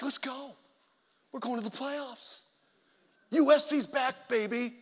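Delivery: excited fan cheer, held back in volume; fired up. Retold or imitated. Friends watching the USC game, fired up. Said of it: "Man, we were fired up".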